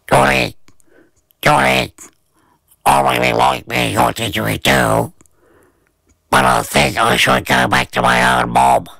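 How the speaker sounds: a shy little creature whispering at bedtime; the little didgeridoo saying goodbye to Johnny in bed. A narrator's reading. Little didgeridoo talks at night.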